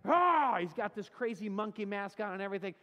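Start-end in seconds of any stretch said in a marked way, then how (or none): 0.1-0.6 sudden loud high "Ah!" yell to startle; mock scare yell. Boyfriend leaps from the woods with a loud scare yell. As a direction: jump out and yell to scare her. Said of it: "He's like".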